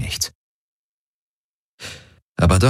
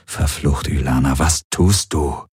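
low, slowing muttered curse; despairing mutter; 5 semitones lower than usual. Acted. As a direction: ask it low, to yourself, in dismay. Despairing private thought about Ylana.

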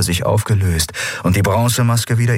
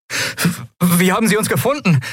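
much higher and fast, voice almost cracking; 13 semitones higher than usual. Acted. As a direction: blurt it out in a panicked squeak. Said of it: "Kais Stimme überschlug sich fast". (Stimme überschlägt sich fast vor Schreck)

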